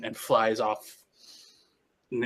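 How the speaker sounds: ordinary talk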